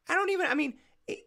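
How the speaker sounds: very high, flustered squeak; flustered disbelief. Real, not acted. Host reacting to the outrageously disturbing story, flustered. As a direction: falter high-pitched, lost for words. Too appalled to find words.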